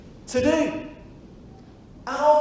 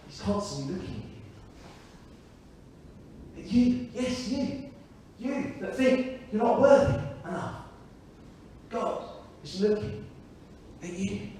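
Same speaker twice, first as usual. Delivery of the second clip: intimate hush; 3 semitones lower, 6 dB quieter than usual. Real, not acted. Preacher speaking intimately to listener.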